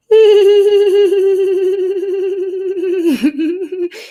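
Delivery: long, high, rippling "hee-hee" giggle; shy laughter. Shown as a demonstration. Coach showing an exercise to her host, shy laughter. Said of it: "is to do shy laughter".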